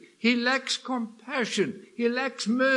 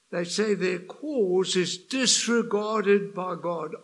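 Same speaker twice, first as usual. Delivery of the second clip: ordinary talk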